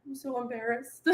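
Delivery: small, slow, very soft voice; quietly mortified, played lightly; mock embarrassed. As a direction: imitate a blushing daughter shrinking away. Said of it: "she's just blushing".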